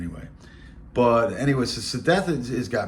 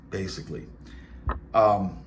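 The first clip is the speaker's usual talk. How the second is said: normal talking voice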